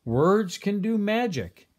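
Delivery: high, slow and wondering; wise owl. Acted. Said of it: "said the owl".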